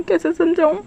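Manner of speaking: exasperated repeat. Acted. Wing gives up explaining to Wangshi, exasperated. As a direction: throw up your hands on कैसे समझाऊँ.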